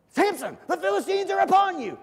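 high, loud mock alarm; hammed-up high-pitched cry; acted yell. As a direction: call out the warning as Delilah. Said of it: "and then she yells".

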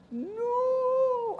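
put-on squeaky child's no; mock child protest; high whiny no. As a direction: whine it high like a scared kid. Boy protests peroxide.

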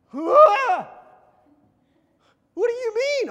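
very high, loud mock panic; scared-kid shriek. Kid told he's going to hell, panicked shriek. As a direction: shriek it like a terrified child. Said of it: "Scared me to death".

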